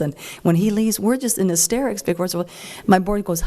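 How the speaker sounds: normal talking voice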